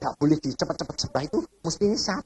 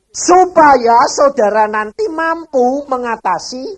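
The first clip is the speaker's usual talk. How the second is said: 1 full-force preaching; 9 semitones higher, 8 dB louder than usual. Real, not acted. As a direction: drive the point home to the congregation.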